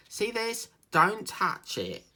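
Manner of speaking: mocking the manager. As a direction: parody a bossy manager laying down the law. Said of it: "and she went".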